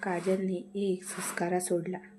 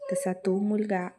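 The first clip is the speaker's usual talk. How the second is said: usual speaking voice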